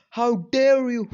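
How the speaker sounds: loud, high, drawn-out outrage; outraged shout. Retold or imitated. Boy confronting his brother over burned scrolls, outraged shout. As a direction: shout it slowly in indignation.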